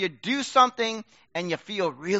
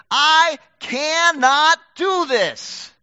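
slow, louder cry of helplessness, pitch lifted; desperate cry; 6 semitones higher, 7 dB louder, much slower than usual. Acted. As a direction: plead it out, drawn slow and strained. Preaching crying out in desperation.

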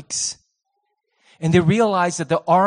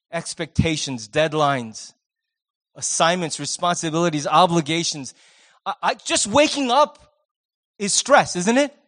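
ordinary talk, nothing marked

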